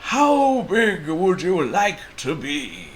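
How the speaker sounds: slow, high, loud wizard voice; Timbuktu Wizard. A narrator's reading. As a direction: draw it out like a mysterious wizard. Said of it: "asked the wizard".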